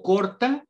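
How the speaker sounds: slow, firm demo of the r in corta; R sound demo. Shown as a demonstration. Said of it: "es otra forma de pronunciar la R". (corta despacio, marcando la r antes de la t)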